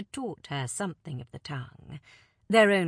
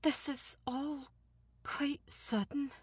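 small tight voice; 8 semitones higher, 7 dB quieter than usual. Acted. Alina holding back grief before the envoy, small and tight. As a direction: squeeze the words out small. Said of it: "Her voice was small and tight".

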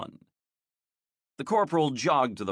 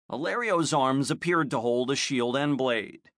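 usual speaking voice

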